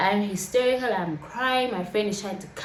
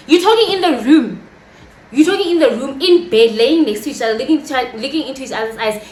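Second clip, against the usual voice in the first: loud, outraged repeating of his excuse; indignant confrontation; 5 dB louder, faster than usual. Acted. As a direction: throw it back at him, punching each phrase. Retold quarrel, throws his words back.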